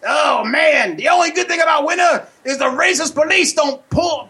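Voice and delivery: put-on high, shouting comic old-timer bit. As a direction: bellow it as a rowdy comic character. Host plays his loud character Pops.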